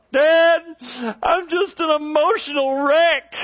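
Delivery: put-on high, loud wailing little girl; imitating crying daughter. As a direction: wail it like a sobbing child. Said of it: "she just said these words".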